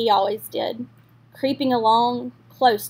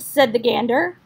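ordinary delivery, nothing marked

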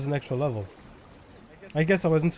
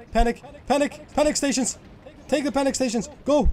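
panicked; 6 semitones higher, faster than usual. Real, not acted. Game panic, calling out stations.

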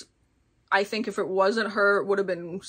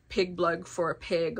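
ordinary talk

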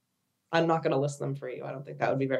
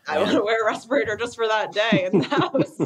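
laughing through the words, a bit louder; 5 semitones higher, 4 dB louder than usual. Real, not acted. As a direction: chuckle it out as you joke. Joking about respirator at home.

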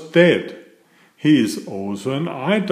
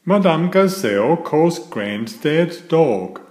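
ordinary delivery

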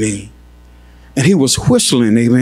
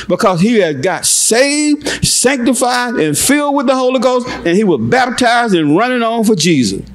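fervent preaching; 9 semitones higher than usual. Real, not acted. Preacher telling church of the husband's salvation, fervently. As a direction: ride high on each phrase, then drop at the close. Preaches the conversion at full force.

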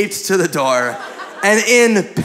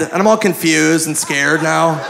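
usual speaking voice

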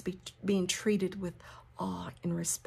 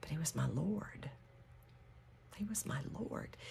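hushed, understated acted grief; quiet acted heartbreak, nearly whispered; distraught; 10 dB quieter than usual. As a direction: say it very softly, as a grieving follower. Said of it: "she's so distraught and crying".

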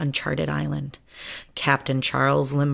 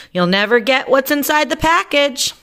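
taunting shout; 10 semitones higher, 6 dB louder than usual. Acted.

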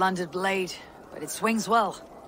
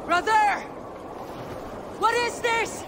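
startled shout to a brother; shocked cry. Acted. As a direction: cry out in disbelief.

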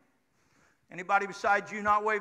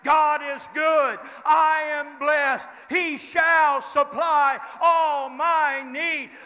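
shouted affirmation; 3 semitones higher, much slower than usual. Shown as a demonstration. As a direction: shout it out with the church. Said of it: "Somebody shout it again".

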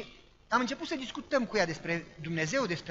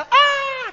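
very high, very loud shriek; piercing scream; 14 dB louder than usual. Retold or imitated. Mother shrieking as the girl falls, piercing scream. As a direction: let out a terrified shriek.

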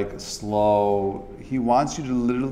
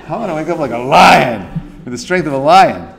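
loud, punchy burst on lion; rousing lion-like cry; 3 semitones higher, 8 dB louder than usual. Acted. Teacher voicing a morning resolve, loud and rousing. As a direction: belt it out with a lion's strength. Rousing contrast to the donut man.

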